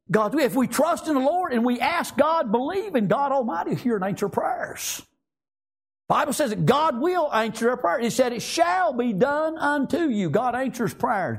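high-pitched, insistent sermon delivery; impassioned preaching. Real, not acted. Preacher assuring the flock God answers prayer, impassioned. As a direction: drive the promise home, pitched high.

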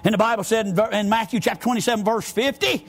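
usual speaking voice

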